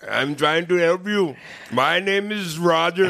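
mock Indian scammer accent, high and loud. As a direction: play the Indian phone scammer, drawn-out name. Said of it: "and he had the accent".

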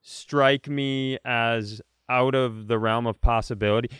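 ordinary talk, nothing marked

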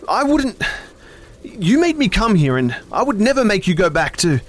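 very high, loud shout. A narrator's reading. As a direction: shout it back. Shouting back.

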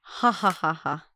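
a hint of a mad scientist cackle; maniacal laugh. Shown as a demonstration. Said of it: "Can you do a maniacal laugh?".

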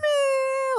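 high cartoon whine; mock child crying. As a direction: imitate a little girl's cartoon cry. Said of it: "Diana was crying so much".

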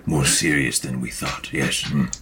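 low, musing character voice; low, hesitant sage with thoughtful hums; grave Maka. Acted. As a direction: ponder solemnly in a low register. Plays Maka, grave figure.

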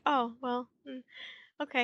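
soft, let-down little reply; Marilla, disappointed. Retold or imitated. Podcaster voices Marilla's let-down face, disappointed. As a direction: imitate quiet disappointment, trailing into resignation. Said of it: "Marilla looks disappointed".

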